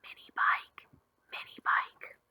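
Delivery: soft unvoiced whisper into the ear. A narrator's reading. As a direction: breathe it secretly in his ear. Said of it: "Tom whispered in his ear".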